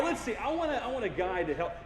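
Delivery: ordinary talk, nothing marked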